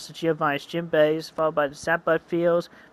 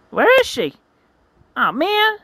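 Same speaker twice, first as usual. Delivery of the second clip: much higher, louder worried cry; alarmed hero; 5 dB louder than usual. Acted. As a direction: panic as you find her missing. Hero discovers girl gone.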